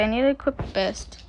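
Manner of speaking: usual speaking voice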